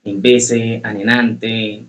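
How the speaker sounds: ordinary talk, nothing marked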